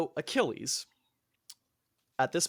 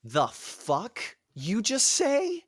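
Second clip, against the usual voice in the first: slow, higher, hammed-up indignation; mock outrage; 8 semitones higher, much slower than usual. Achilles confronts Agamemnon in slow, hammed-up outrage. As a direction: drag it out like a vain hero. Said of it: "Achilles goes".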